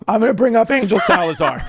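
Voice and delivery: high, hammed-up announcer voice; mock announcer intro. Comic retelling his own stage intro in a mock MC voice. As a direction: boom it out like a club MC.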